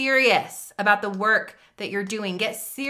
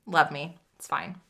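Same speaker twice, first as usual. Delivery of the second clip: usual speaking voice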